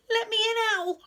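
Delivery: high, loud, urgent plea; crying out. A narrator's reading. Rabbit outside Owl's door in the storm, crying out. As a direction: call out to be let in. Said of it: "cried Rabbit".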